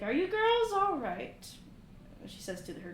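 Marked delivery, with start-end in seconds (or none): 0.0-1.5 concerned mother. Acted. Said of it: "She's like".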